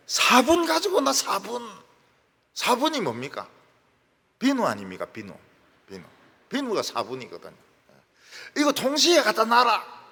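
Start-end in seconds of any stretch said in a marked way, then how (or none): 0.1-1.7 much higher, louder Gyeongsang insistence; impatient Gyeongsang repeat. Retold or imitated. Mother-in-law correcting the daughter-in-law, impatient high Gyeongsang. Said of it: "화분인 줄 알고 들고 왔더니". 8.6-10.0 loud, high Gyeongsang order; Gyeongsang mother-in-law. Retold or imitated. Mother-in-law ordering her daughter-in-law, loud Gyeongsang dialect. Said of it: "경상도 시어머니가".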